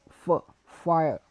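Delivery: ordinary delivery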